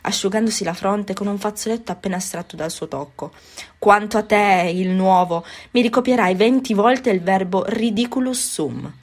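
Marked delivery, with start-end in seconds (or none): 3.8-8.9 put-on loud, harsh punishment for the new boy. A narrator's reading. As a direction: lay down the sentence sternly. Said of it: "Poi, con voce meno aspra". (il professore punisce il nuovo con voce aspra)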